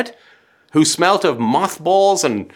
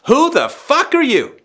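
high indignant who-are-you; indignant mock baby voice; baby voiced as outraged adult; 10 semitones higher than usual. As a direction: play the baby, pitched up and affronted. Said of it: "and your son says".

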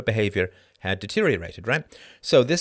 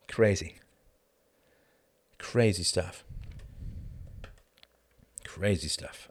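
weary disbelief; 4 semitones lower, 6 dB quieter, slower than usual. Real, not acted. Sighing, fading disbelief.

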